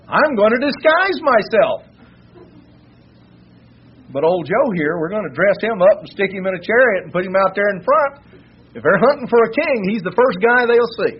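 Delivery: sly king relishing his trick; gleeful scheming. Retold or imitated. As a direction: gloat over the clever plan. Ahab's scheming thoughts voiced.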